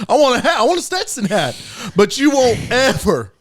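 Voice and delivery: usual speaking voice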